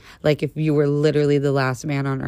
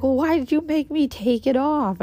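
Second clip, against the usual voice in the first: put-on confused old man; 10 semitones higher than usual. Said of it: "And he's like".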